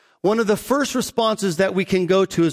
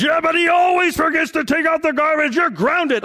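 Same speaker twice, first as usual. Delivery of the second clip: acted angry parent; 9 semitones higher than usual. Parent yelling at little Johnny over garbage, shouting angrily. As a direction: blow up at the kid over the garbage. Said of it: "that is unhealthy anger".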